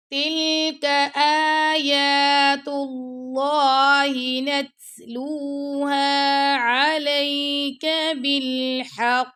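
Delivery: slow, melodic tajweed chant; teacher chanting a verse for students; Quran recitation. Shown as a demonstration. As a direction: recite it slowly with full tajweed.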